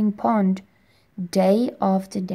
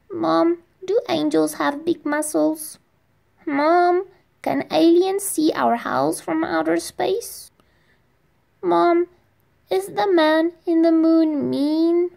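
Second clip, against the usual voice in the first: put-on a scared kid stalling bedtime; little boy asking his mother about monsters at night; anxious little boy; 9 semitones higher than usual. Child asking mom again.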